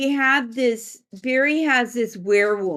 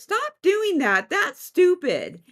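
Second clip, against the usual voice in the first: slow, high-pitched scolding; scolding spirit; 7 semitones higher, slower than usual. Retold or imitated. One unseen being scolds the stair-climber, slow high scolding. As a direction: drag out the mock annoyance. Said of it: "And he goes".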